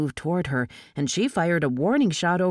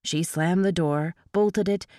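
ordinary delivery

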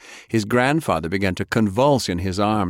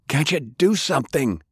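raised, high desperate appeal; shouting; 7 semitones higher than usual. A narrator's reading. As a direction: call out to a crowd for help, not full volume. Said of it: "Francis shouted".

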